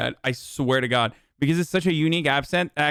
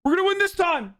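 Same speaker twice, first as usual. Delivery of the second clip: high smug mock chant, stress on this; cocky mock supporter voice, pitched way up; mocking Trump supporters; 9 semitones higher than usual. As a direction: sneer it high and cocky, over and over. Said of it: "And they're like".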